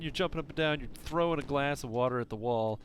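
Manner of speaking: ordinary delivery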